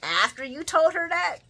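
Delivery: high, loud outraged questions; indignant high challenge; incredulous sass. Retold or imitated. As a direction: grill him in disbelief. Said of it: "And I'm like".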